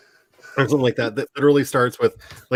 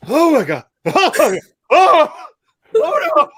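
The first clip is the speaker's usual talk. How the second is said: mock horror; 7 dB louder than usual. Horrified renter watching the tape.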